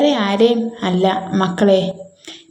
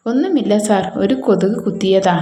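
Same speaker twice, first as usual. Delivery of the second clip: ordinary delivery, nothing marked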